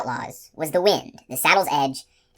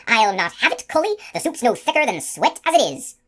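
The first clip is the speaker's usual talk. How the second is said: much higher, sharp scold; shrill scolding woman; 7 semitones higher, 3 dB louder than usual. A narrator's reading. Thin woman scolding the captain about the soup, high and sharp. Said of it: "to shrill".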